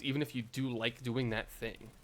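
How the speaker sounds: ordinary delivery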